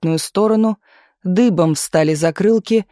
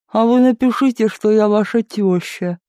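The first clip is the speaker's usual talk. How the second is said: whining housekeeper; 4 semitones higher than usual. A narrator's reading. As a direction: whine it through a sore, swollen mouth. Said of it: "ныла домработница".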